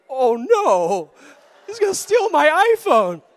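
rising to a high, loud whine; hammed-up dread climbing in pitch; mock panic. Preacher fearing the gangster will take his phone, mock panic.

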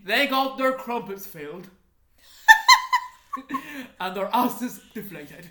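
hammed-up British accent through laughter; attempted British accent. Narrator asked to describe soldiers' deaths, laughing British accent attempt. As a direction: put on a badly faked British accent, cracking up. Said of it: "describe their deaths in a British accent".

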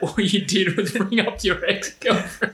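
high, slow imitated scolding sister; lightly voiced exasperated sister; sister's rebuke.

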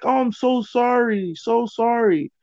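high, slow apology; retold pleading. Wife apologizing to the friend, retold pleading. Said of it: "kinda pleading with my friend".

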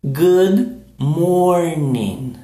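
very slow drawn-out Good morning. Shown as a demonstration. Teacher drilling the phrase very slowly. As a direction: stretch it slowly with a rise. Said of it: "So repeat".

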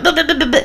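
high, rapid nonsense chatter; imitated bickering babble. Showing mother and daughter going at each other, babbled bickering. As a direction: mimic squabbling as quick high blah-blahs. Imitating mutual arguing with mom.

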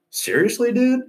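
high, rising mock-tough "Seriously, dude?"; fake-tough disbelief. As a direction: play it brave but squeak it up high. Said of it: "trying to act buff".

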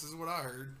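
ordinary talk, nothing marked

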